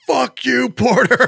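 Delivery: high mock shout at a player; fans yelling. Jet fans in the stands jeer Porter, mock shouting. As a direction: yell it like a rowdy Jets fan. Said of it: "they were like".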